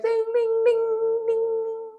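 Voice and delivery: high, sung alarm bell; alarm bell ding. Pretend. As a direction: ring it like a warning bell. Red flag alarm bell.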